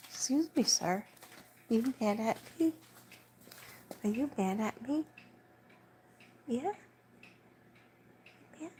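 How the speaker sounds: high, teasing pet talk with rising questions. Real, not acted. Owner teases her sulking dog, high playful coo. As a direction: coo at the dog as if he's a grumpy man.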